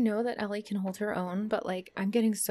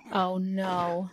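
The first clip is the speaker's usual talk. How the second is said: normal talking voice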